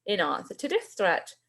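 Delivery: ordinary delivery, nothing marked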